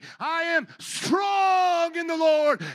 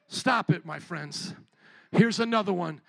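ordinary talk, nothing marked

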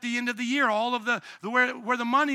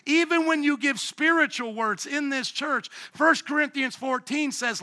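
ordinary delivery

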